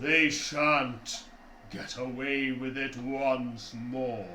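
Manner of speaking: giant voice. Acted. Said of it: "echoes the voice throughout the canyon".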